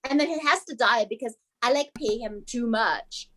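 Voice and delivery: put-on British accent, chatty star. Improviser playing a superstar for a game, British accent. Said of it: "I don't know why I'm British now".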